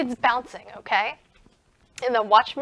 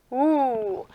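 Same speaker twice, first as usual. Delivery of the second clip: normal talking voice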